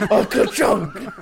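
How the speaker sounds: slow printing press voice. Pretend. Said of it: "Adam, what happened".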